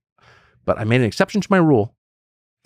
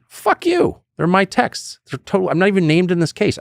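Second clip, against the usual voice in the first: defiant outburst; 8 semitones higher than usual. Retold or imitated. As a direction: tell them off the way he wishes he had. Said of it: "that's what I should have said".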